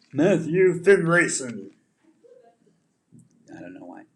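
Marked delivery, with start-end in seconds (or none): 0.1-1.7 loud, high, drawn-out ring announcer's call; wrestling announcer. Pretend. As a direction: announce a wrestler's big entrance. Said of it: "I feel like that's a wrestler's name".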